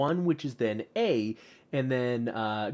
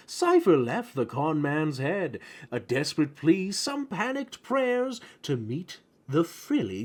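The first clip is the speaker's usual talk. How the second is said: higher-pitched, theatrical rhyming recitation; lilting storyteller verse; poem read aloud; 4 semitones higher than usual. Acted. Reads rhyming poem from book.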